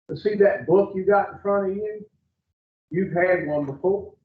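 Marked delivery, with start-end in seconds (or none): none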